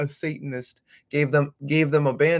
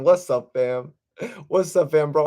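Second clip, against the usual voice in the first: ordinary talk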